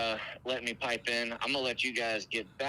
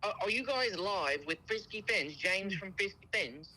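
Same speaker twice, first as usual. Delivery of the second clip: high put-on British accent; insistent prank caller, fake British voice; 6 semitones higher than usual. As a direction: press the question in mock British. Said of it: "the best British accent I've ever heard".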